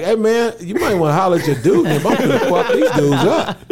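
loud, high, indignant venting; heated mock threat. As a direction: complain to your boy, voice shooting up. Angry phone call to his friend, retold.

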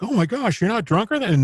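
high, amazed voice; amazed onlookers. Retold or imitated. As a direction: gasp it like stunned onlookers. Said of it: "they're like".

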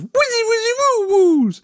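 high-pitched silly wizzy woo; high mock spaceship warbles; sci-fi noises. Shown as a demonstration. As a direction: leap way up into cartoon sci-fi sound effects. Imitating sci-fi podcast noises.

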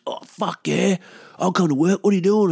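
high-pitched, hyped-up imitation of a mate; acted gung-ho enthusiasm; eager mate. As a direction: jump at it like an over-keen drinking buddy. Mate eagerly volunteers for work.

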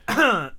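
throat clearing. Real, not acted.

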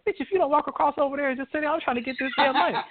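fast, high, bossy mock snap; mock angry hustler. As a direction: rattle it off like an impatient crackhead. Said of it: "He goes like".

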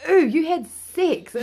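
high, slow, drawn-out teasing; mock the little sister's sing-song tease; imitating her younger sister's cheeky ooh. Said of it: "all she said to me was".

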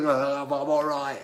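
slow, slurred mock drunk. Drunk roadie tells Roger he is fine, slow slurred mock drunk. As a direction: drag it out like a drunk man insisting.

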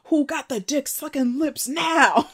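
high, slow, taunting villain; evil villain. Retold or imitated. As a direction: gloat slowly at a pitch well above normal, near laughter. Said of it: "on her evil villain shit".

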